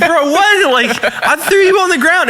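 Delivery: high-pitched retold disbelief; incredulous. Bouncer answering the guy he already threw down, incredulous. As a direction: throw it back at him, voice jumping up.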